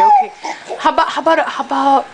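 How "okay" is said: laughing. Real, not acted. Laughing while feeding baby.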